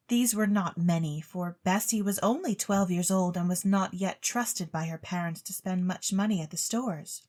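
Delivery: ordinary delivery, nothing marked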